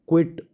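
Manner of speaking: क्विट said short, kwit; quiet vs quite contrast. Shown as a demonstration. Teacher contrasting क्विट with क्वाइट for students. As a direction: say क्विट crisply, one syllable. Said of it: "तो देखो last में इसमें ई है".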